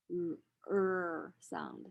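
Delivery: slow, stretched retroflex er sound; r-colored er. Shown as a demonstration. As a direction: curl the tongue back and hold the er. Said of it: "retroflex liquid R".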